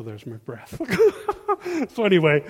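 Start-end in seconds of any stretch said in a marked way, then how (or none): 0.9-1.8 a sudden high burst of laughter at himself. Real, not acted. Laughs at himself after sighing.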